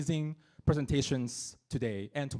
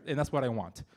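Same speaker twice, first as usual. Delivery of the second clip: ordinary talk, nothing marked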